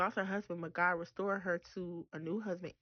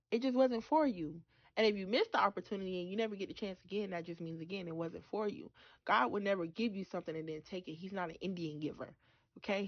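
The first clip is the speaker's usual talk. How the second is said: normal talking voice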